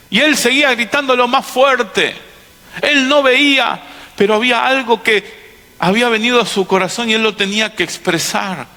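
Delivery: high, forceful preacher's storytelling; impassioned preaching. Acted. Preacher telling the congregation of blind Bartimeo, impassioned. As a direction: preach it out, pitched well up.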